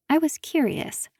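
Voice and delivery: ordinary delivery, nothing marked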